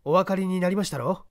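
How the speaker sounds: a lightly voiced, unhurried gentleman sleuth; composed gentleman detective. The detective calmly directing the man up the ladder.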